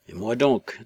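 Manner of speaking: ordinary delivery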